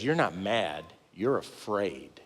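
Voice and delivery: low, soft and slow inner voice; quiet realization. Retold or imitated. His own trigger telling him the truth, soft and slow. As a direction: say it gently, like a truth dawning.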